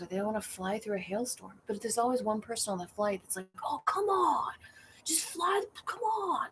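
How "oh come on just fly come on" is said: high, whiny mock pleading; imitating an entitled passenger who wants to fly, pitched high.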